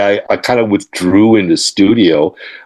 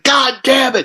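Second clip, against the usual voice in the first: mock frustrated curse. [name] cursing in studio.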